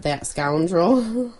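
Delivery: slow, weighty, every syllable drawn out; slow dramatic. Retold or imitated. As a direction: drag out "That scoundrel." slowly with mock gravity.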